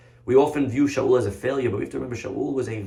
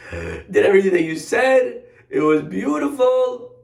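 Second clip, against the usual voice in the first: gleeful boasting; 9 semitones higher, 6 dB louder than usual. Acted. King reports to the prophet on his mission, gleefully boasting. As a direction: sell it with overblown delight.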